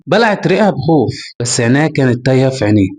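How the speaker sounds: ordinary delivery, nothing marked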